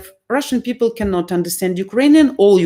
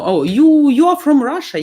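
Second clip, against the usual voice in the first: high, louder surprised guess; surprised stranger; 7 semitones higher, 5 dB louder than usual. Retold or imitated. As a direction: imitate people amazed by the lack of accent. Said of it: "people start to tell me".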